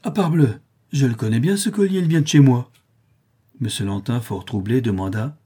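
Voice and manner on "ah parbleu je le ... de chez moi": higher, louder cry of recognition; a touch of delighted surprise; exclaiming. A narrator's reading. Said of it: "l'orfèvre s'écria".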